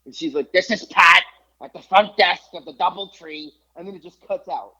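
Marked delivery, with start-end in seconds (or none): 0.5-3.5 put-on loud, high-pitched old desk lady; mock shouted phone message; Pat on voicemail. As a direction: holler it as an imitation of a voicemail caller. Imitates old lady's loud voicemail.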